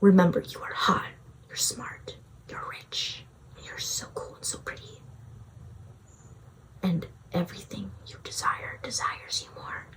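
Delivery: soft breathy whisper close to the ear; whispered affirmations. Shown as a demonstration. Future self whispers affirmations into past self's ear. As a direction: murmur praise right into their ear. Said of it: "whisper method".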